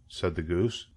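ordinary delivery, nothing marked

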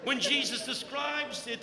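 normal talking voice

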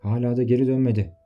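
put-on old tavern keeper. A narrator's reading. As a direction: greet the regular cheerfully.

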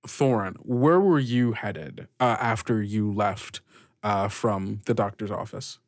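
ordinary delivery

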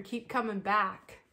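drawn-out, sing-song keep coming back; stretched, syrupy mock recovery-slogan delivery; slow mock slogan. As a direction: drag out Keep coming back slowly and sweetly.

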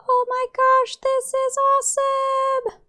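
very high, drawn-out squeal of joy; elated. Real, not acted. Player on first Pokemon flight, squealing elatedly. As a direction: squeal it slowly, pitched sky-high.

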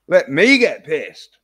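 mock loud demand. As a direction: shout it like a mocking chant. Mocking drunk man's wish.

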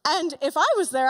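ordinary delivery

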